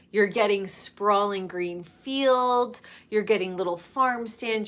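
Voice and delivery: usual speaking voice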